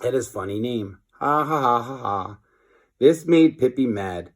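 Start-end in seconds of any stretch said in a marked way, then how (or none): 1.1-2.4 spoken mocking ha-ha; mock laughter. Kids mock the teacher's funny name. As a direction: read the laugh out like kids jeering. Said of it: "Everybody laughed at his name".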